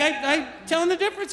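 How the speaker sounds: put-on high, fast toddler voice with broken grammar; a little kid proudly explaining; little girl explaining. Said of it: "She's like".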